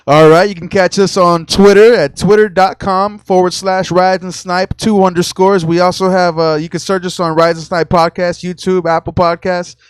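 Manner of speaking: loud rapid-fire auctioneer patter; auctioneer speed. Acted. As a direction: rattle it off fast and loud like an auctioneer. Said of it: "Not everybody was born to be an auctioneer".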